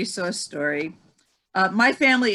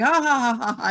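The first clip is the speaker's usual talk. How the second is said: mock ha-ha laugh, higher; imitated laugh; 4 semitones higher than usual. Telling a friend's laugh at a cooking mix-up, mock ha-ha laugh. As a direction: act out a friend laughing at you. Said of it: "And he starts laughing".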